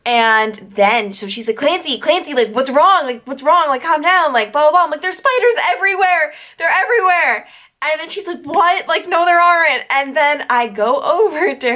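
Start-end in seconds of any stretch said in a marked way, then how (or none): 1.6-4.8 higher-pitched, lightly voiced worried roommate; alarmed roommate. Roommate to screaming sleepwalker, higher urgent mimicry. 5.0-7.5 high-pitched frantic retelling; mock panic. Sleepwalker to roommate about spiders, high mock panic. 8.4-9.8 higher, lightly mimicked disbelief; baffled roommate. Roommate denies seeing any spiders, high-pitched mock bafflement.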